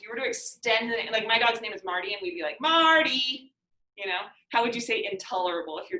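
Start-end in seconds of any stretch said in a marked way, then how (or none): 2.6-3.5 higher drawn-out call for a pet; calling the dog. Shown as a demonstration. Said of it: "How would you call for that dog".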